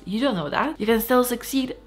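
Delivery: ordinary talk, nothing marked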